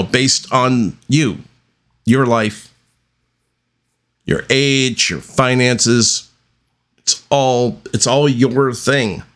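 ordinary delivery

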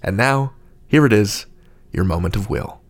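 normal talking voice

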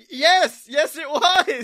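high, loud outburst of admission; mock defiant yell. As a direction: shout the confession back, hammed up. Yells answer in imagined questioning.

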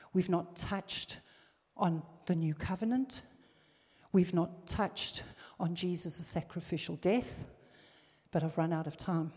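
usual speaking voice

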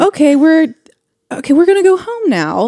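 high, hesitant mock apprehension; retold doubt. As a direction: imitate a new parent losing confidence. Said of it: "to just doubt".

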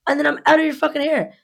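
high, exasperated rapid insistence; re-enacted indignant rant; retold heated retort. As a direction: argue back fast, pitch up high. Her side of retold quarrel.

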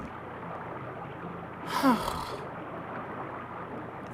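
long exasperated sigh out; weary long sigh. Real, not acted. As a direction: let out a drawn-out fed-up sigh. Exasperated by a dull episode.

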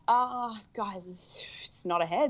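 breathy giggling whisper through the words; half-laughing, half-whispering in disbelief. Retold or imitated. To the midwives, whispering through a laugh. Telling midwives it's not head.

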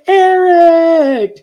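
very high, loud drawn-out cheer; excited group cheer. Retold or imitated. The class greeting Eric, loud high cheer. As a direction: welcome him like a hero.

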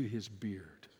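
breathy whispered ending; soft trailing whisper. Acted. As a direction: let the words fall into a whisper.

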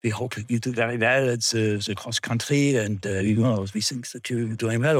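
lightly imitated smoochy teacher with Swiss accent; butter up a favourite pupil, accented; mildly ingratiating impression of a Swiss teacher; weird smoochy. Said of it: "turn on this weird sort of smoochy thing".

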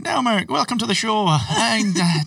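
high, bright host impression; imitating Gav. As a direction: ham up the chirpy host welcome. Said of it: "he's like".

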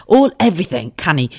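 ordinary delivery

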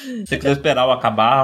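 talking while chewing; mouth full. Real, not acted. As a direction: speak around a mouthful of snack. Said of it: "eu tô comendo de novo".

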